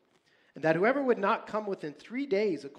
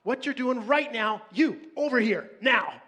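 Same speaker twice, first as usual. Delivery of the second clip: loud, much higher urgent shout; forceful parent command; 6 semitones higher, 6 dB louder than usual. Pretend. Parent yelling at child running into the street, forceful shout. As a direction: bark it like a car is coming. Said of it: "you get pretty serious and forceful".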